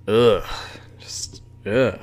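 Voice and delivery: whispered groan of disgust; breathy ugh, like smelling something foul. Real, not acted. Disgusted at losing to Jets.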